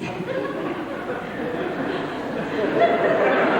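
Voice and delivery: high airy laughter at the memory; breathy laugh. Real, not acted. Remembering the pastor's lobster joke, airy amused laugh.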